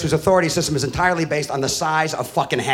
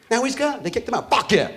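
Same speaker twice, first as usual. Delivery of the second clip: normal talking voice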